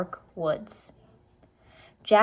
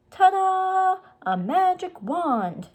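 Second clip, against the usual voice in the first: high, loud showy reveal; playful ta-da; 12 semitones higher, 4 dB louder, slower than usual. Acted. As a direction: announce it like a magician. Annie shows off flashlight.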